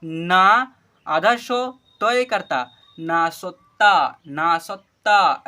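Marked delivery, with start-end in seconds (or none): 3.0-5.4 "ना सत्ता" very slow, syllable by syllable, loud and clear; drawn-out model reading. Shown as a demonstration. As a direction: stretch each syllable of "ना सत्ता" for learners. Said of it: "ऐसे पढ़ेंगे". ("ना सत्ता" को ज़ोर से, खींचकर पढ़ें)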